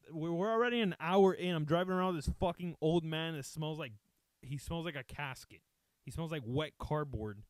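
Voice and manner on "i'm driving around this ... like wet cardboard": ordinary talk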